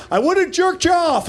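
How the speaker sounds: high-pitched elated blurt; giddy call back. Retold or imitated. Customer calling back to the worker as he runs, high and giddy. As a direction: pitch jumps up, tossed back gleefully. Excited parting shout to worker.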